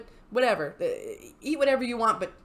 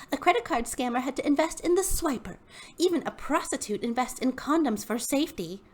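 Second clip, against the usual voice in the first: higher, preachy sales-pitch voice; Hunbot voice; 6 semitones higher than usual. Acted. Reading the hun's post aloud, in a smug higher sales voice. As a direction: read it like a smug MLM hun. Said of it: "read in the Hunbot voice".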